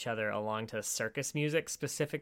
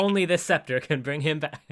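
louder, hammed-up proclamation; mock crazy explaining; 3 semitones higher, 7 dB louder than usual. Explaining to the Lord about his son, mock-crazy proclamation. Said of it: "she explains completely non-crazily".

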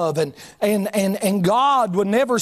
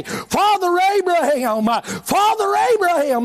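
anguished cry; 7 semitones higher than usual. Acted. Rich man crying out in hell.

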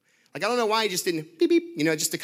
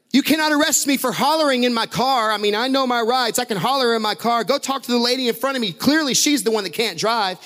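loud, higher belligerent rant; belligerent shouting; 6 semitones higher, 6 dB louder than usual. Retold or imitated. Angry driver shouting at the officer, loud belligerent rant. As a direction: shout him down, indignant. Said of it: "he's even more belligerent now".

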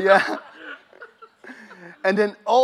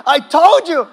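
loud, higher retold "told you so"; mock scolding shout; 7 semitones higher, 10 dB louder than usual. Preacher replaying the third lady's scolding, mock exasperated shout. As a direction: burst out louder and higher, half laughing.

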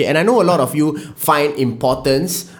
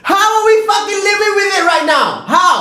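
angry shout; 14 semitones higher than usual. Real, not acted. Ranting at the government's rules, shouting in anger. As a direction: yell it in disbelief.

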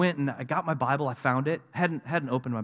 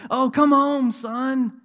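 high, loud wailing mother impression; mock bawling; 12 semitones higher, 8 dB louder, much slower than usual. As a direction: cry out to your boy, comically overdone. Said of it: "She just starts bawling".

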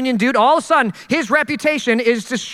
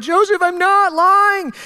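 mock crying plea; 6 semitones higher, slower than usual. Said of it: "Mary's crying like".

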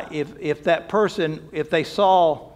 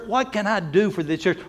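usual speaking voice

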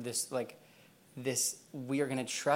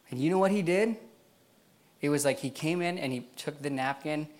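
ordinary talk

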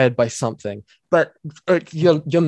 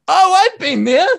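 very high, louder squealed "Oh, I've been there"; mock viewer reaction; 6 dB louder than usual. Mocking people reacting to a meme in a high squeal. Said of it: "is people going".

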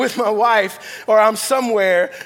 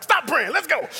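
higher, urgent wife voice; impatient wife; 6 semitones higher than usual. Retold or imitated. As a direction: hurry him along, half laughing. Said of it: "my wife was like".

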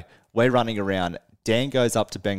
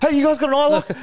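much higher, louder mock frantic call; frantic ask; 7 dB louder, faster than usual. Bursting into another band's room asking for an eye lock, high frantic mock plea. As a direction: burst in squeaking the question. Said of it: "It's like".

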